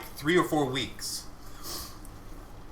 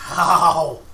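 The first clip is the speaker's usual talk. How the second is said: pained ow; 10 dB louder than usual. Real, not acted. Burned again.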